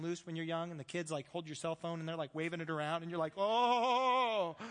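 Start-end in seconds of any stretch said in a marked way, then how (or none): 3.4-4.6 mock alarmed, drawn-out "oh"; louder, higher, stretched-out cry of alarm; alarmed gasp. Mimics reaction to kid waving phone.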